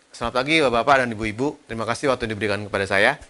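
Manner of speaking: fast, low mumble, syllables run together; bergumam. Shown as a demonstration. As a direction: mumble the greeting with a barely moving mouth. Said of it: "Itu bergumam". (bergumam cepat, suku kata tidak jelas)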